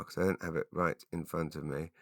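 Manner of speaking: muttering to self. Real, not acted. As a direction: drop to a quiet mutter. Muttering aside at computer.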